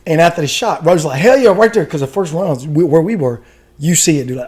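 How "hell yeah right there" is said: loud, high-pitched whoop; imitating a fired-up gunner's approval; excited cheer. Gunner cheering the rounds landing, excited.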